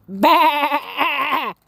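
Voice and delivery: angry monster shouting Bah!; big mad roar. Acted. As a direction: let rip a cross monster roar. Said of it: "let out a big mad".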